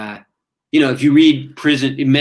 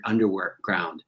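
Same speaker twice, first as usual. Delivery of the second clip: normal talking voice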